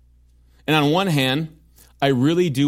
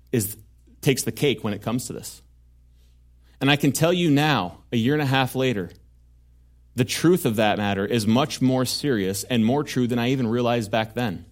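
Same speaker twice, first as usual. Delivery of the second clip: normal talking voice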